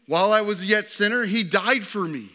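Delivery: high, loud preacher's testimony; impassioned preaching. Real, not acted. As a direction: preach it out with rising fervour. Preaching at full force.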